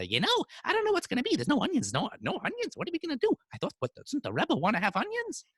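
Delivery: high, fast gossipy worry; fretting women. Retold or imitated. Women gossiping to each other, high and anxious. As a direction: chatter anxiously like village women.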